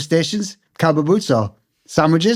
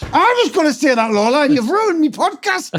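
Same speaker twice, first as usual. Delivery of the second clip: higher, playful mock indignation; mock outrage; 10 semitones higher than usual. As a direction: pretend to be robbed of your punchline. Mock outrage at being pre-empted.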